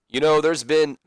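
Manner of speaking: badly attempted Scottish Roddy Piper accent; Roddy Piper's accent. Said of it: "that's a horrible accent".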